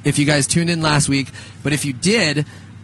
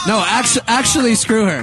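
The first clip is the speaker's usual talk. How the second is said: joking shout; 6 semitones higher than usual. Real, not acted.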